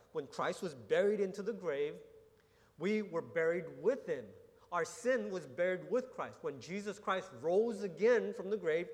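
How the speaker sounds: swelling sermon proclamation; rising preaching fervour. Real, not acted. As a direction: preach it with growing conviction to the church. Preaching builds to climax.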